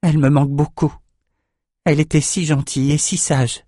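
a touch of grief, held back; understated sadness. A narrator's reading. Ambre missing the abbess before her brother, hidden sadness. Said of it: "pour cacher sa tristesse".

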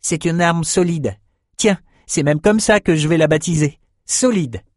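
high, earnest youthful voice; plucky young man. A narrator's reading. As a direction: stand up for your sword with pride. Young man defends his sword.

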